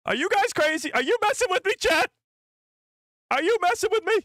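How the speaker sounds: very high-pitched exasperated questioning; incredulous squawk. Real, not acted. Streamer accusing chat of messing around, wildly incredulous. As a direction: demand answers in a high, disbelieving squeal.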